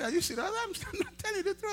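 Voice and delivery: high, laughing mock of the sick man; laughing disbelief. Sick man doubts the pastor, high laughing.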